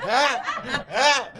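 two sudden high yelps, hammed up; mock stage yelps. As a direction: yelp like he's hyping the crowd. Mimics his stage yells. (deux cris aigus, exagérés pour rire)

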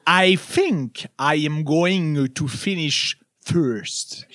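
every syllable spelled out slowly; anti-ventriloquist over-articulation; over-enunciated. Shown as a demonstration. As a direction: mouth each syllable big and slow. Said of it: "I pronounce enormously each syllable".

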